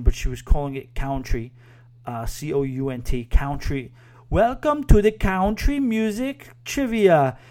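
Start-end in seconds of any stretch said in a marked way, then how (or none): 4.3-7.4 high, loud, slow Borat-style announcement; hammy Borat-ish host voice, stretched out; Borat-like accent. Retold or imitated. Trivia host opening the contest, in a high Borat accent. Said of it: "I'm gonna do a horrible impression".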